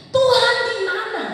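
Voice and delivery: high, loud cry to God; acted desperate appeal; anguished outcry. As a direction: call out as if searching for God. Crying out the heart's question.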